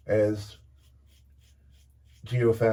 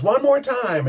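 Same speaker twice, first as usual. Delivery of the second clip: mock stage shout; 12 semitones higher, 5 dB louder than usual. Singer calls to the band.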